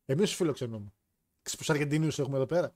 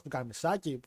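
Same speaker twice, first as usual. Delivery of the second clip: normal talking voice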